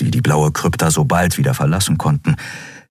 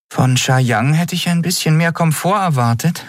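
higher-pitched, not quite unimpressed; feigned indifference; 10 semitones higher than usual. A narrator's reading. Nelen to the others in the empty hall, feigned indifference. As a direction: try to sound unimpressed and not quite manage it. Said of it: "Stimme klang nicht halb so unbeeindruckt".

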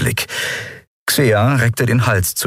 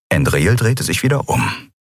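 usual speaking voice

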